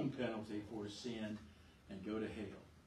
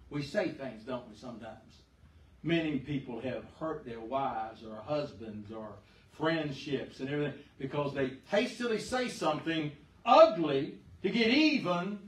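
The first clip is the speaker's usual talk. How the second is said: ordinary talk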